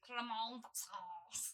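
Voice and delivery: slow, exaggerated French "Cremant d'Alsace"; French accent. Shown as a demonstration. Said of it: "That is not what she said".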